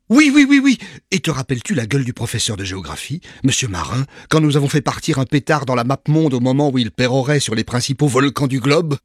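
put-on high, loud, boisterous old friend; a brash parvenu inviting his pal; jovial Patience. A narrator's reading. Patience recalling pranks. (ami bruyant et jovial)

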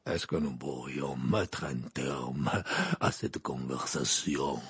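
low, slow growl; growling Maugrey. A narrator's reading. Old Auror cutting off the argument, growling. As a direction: growl it out, drawing the words out. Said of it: "grogna Maugrey".

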